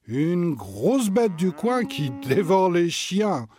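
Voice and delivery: slow, teasing spooky tone; amused. Acted. Uncle teasing the children about the beast, slow and amused. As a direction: tease the kids slowly. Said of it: "leur lance leur oncle amusé".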